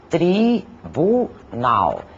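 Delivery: "tribunal" slowly split into tri, bu, nal; very slow, separated "tri-bu-nal"; syllable by syllable. Shown as a demonstration. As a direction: pause between each syllable of "tribunal". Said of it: "composta por três sílabas".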